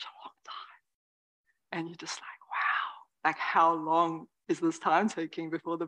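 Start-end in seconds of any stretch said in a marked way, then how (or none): none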